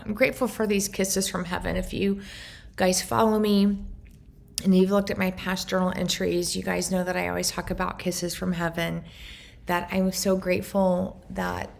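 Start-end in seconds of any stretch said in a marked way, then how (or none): none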